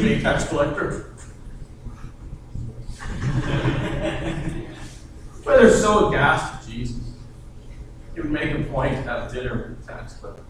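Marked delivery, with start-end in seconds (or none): none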